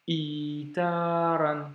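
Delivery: itaran, slow, a tiny catch before a long i; slow Arabic reading model; glottal stop demo. Shown as a demonstration. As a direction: block the breath, then stretch the i in itaran. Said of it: "un petit blocage juste avant et ensuite le i long".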